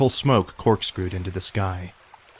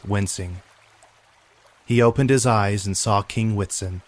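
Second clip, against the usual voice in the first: plain reading voice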